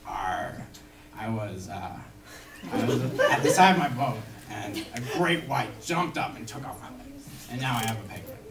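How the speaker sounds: low gruff pirate growl, dropped well down. Acted. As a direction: growl it like an old sea pirate. Pirate voiced in joke.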